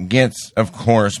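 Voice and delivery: normal talking voice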